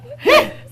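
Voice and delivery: high excited squeal laugh. Real, not acted. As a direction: squeal with delight. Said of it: "it comes naturally when I get excited".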